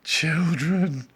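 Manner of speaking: high, loud horrified scream; appalled high outcry. A narrator's reading. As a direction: scream it in alarm. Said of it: "screamed Edmund".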